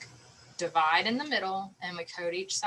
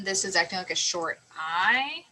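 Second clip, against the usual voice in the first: usual speaking voice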